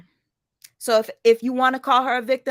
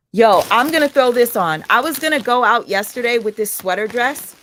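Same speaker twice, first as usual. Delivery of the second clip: loud announcement; 3 semitones higher, 8 dB louder than usual. Real, not acted. Announcing her outfit loudly.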